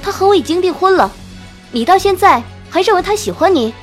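loud, high-pitched angry retort; indignant. A narrator's reading. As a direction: throw it at a rival, indignant. Acted quarrel with a rival.

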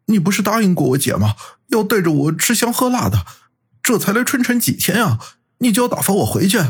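high, rising whiny protest; unwilling. A narrator's reading. Qi Bing begging brother-in-law not to send him away, unwilling. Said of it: "骑兵不甘心地说".

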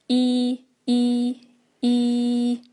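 一 as a stretched "ee" with lips spread wide; long ee. Shown as a demonstration. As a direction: hold each 一 long and level. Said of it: "嘴型往左右咧开".

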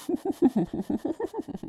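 high eerie doll giggle; creepy rising cackle; sinister laugh. A narrator's reading. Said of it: "人偶再次发出阴森的笑声". (阴森的笑声)

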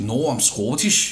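put-on slow, rolling Scottish accent. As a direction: say it like a Glaswegian. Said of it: "用英文讲".

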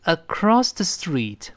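across the street, read slowly with a clear cr; slow model reading. Shown as a demonstration. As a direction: across the street, each word spelled out slowly. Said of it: "读一下".

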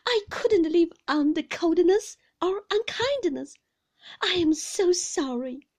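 high, loud, distressed confession; pleading apology. A narrator's reading. Mother apologising to her husband, high and pleading.

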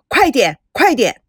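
loud, urgent shout; soldier shouting. A narrator's reading. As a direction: yell through the door to hurry. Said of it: "士兵又大声疾呼".

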